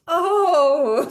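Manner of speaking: loud, high, stretched oh; hammed-up storybook dismay; dawning realisation. A narrator's reading. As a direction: let the realisation land big. Character realises the giveaway boot.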